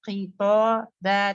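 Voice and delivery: खिताबा with heavy full खि then light बा; खिताबा, thick start, thin ending. Shown as a demonstration. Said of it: "बिल्कुल heavy है और बा लाइट है".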